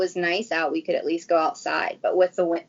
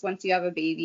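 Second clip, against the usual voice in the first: ordinary delivery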